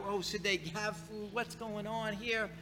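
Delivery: soft, muttered wondering to himself; puzzled inner thought. Retold or imitated. Pastor voicing his own puzzled thoughts.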